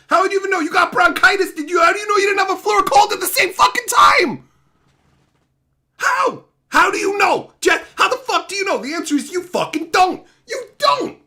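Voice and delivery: high, loud and fast interrogation; angry yelling. Real, not acted. Streamer yelling at Jeff about how he knows he wasn't sick. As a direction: demand an answer, furious.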